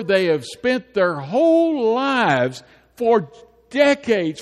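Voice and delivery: building preacher's fervour; dramatic preaching. Acted. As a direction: paint the danger for the church. Describing Canaan's fearsome armies.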